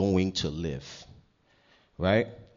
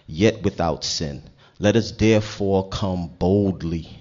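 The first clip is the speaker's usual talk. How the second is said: loud, emphatic scripture reading; preaching scripture; 6 dB louder than usual. Acted. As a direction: proclaim the verse to the congregation. Reads scripture to congregation.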